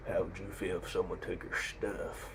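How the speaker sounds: slow, quiet menacing biker; cliché action-movie tough guy. Retold or imitated. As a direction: draw it out softly like a movie heavy. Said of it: "someone so cliche in an action movie".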